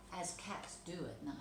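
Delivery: plain reading voice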